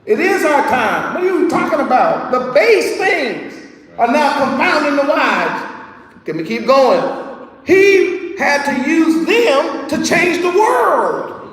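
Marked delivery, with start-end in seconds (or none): 2.3-11.4 preaching at full force. Acted. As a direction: drive the point home slowly.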